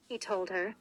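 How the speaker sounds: ordinary delivery, nothing marked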